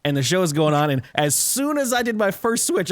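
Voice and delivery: usual speaking voice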